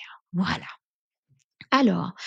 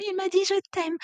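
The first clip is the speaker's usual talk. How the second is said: much higher, giddy and breathless; giddy thrill; 11 semitones higher, 4 dB quieter, faster than usual. Pretend. Woman just told I love you, higher giddy thrill. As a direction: squeal it like a smitten girl.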